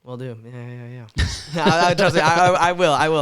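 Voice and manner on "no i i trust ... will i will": laughing and loud, promising eagerly. Real, not acted.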